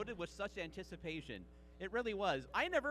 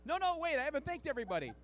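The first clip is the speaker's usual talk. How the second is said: mock acceptance speech; 8 semitones higher than usual. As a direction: hurry a speech before the music cuts in.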